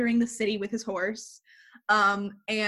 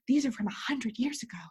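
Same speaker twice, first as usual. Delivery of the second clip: whispering; 3 semitones higher, 3 dB quieter than usual. Retold or imitated. Librarian quoted in retelling.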